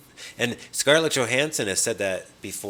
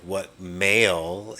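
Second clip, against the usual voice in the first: slow mock quote; 2 semitones lower, much slower than usual. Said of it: "I don't think he meant, like".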